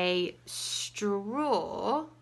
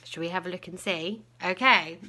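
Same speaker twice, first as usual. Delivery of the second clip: plain reading voice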